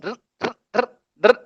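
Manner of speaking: a small trill on "r"; light rolled r. Shown as a demonstration. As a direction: roll the "r" just a little. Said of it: "bir ada sedikit getaran".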